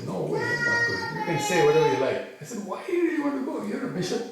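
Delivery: high-pitched retold protest; acting out an exasperated no; incredulous refusal. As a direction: question him in disbelief, pitch jumping up. Retold refusal, questioning the bishop.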